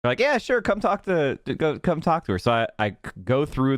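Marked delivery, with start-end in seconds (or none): 0.2-2.4 higher-pitched imitation of an obliging reply; retold breezy yes. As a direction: quote their easy agreement in a raised pitch. Retold friendly agreement to talk.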